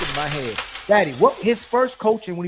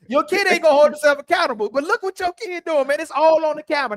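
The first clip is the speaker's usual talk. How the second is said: higher, louder, laughing rant; excited rant; 9 semitones higher, 4 dB louder than usual. Real, not acted. Mocking the guest like a daycare kid, excited laughing rant. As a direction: bubble over with laughter.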